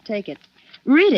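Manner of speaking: sharp demand. Acted. Eve confronts Mark with the letter.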